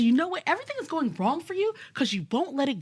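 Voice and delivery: a hint of mom laying down the law; mother scolding her daughter in the car; mom's firm voice. Mom's voice retold, changed.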